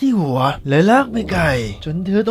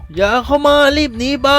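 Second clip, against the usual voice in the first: desperate warning; 5 semitones higher than usual. A narrator's reading. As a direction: beg her to run. Bitten father warns daughter away.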